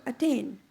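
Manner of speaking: "attain" with a light "at", weight on "tain"; stress on second syllable. Shown as a demonstration. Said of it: "putting the stress on the second syllable".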